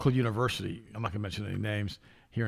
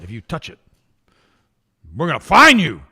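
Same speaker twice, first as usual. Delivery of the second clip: mock threat; 5 semitones higher, 14 dB louder than usual. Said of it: "they were told".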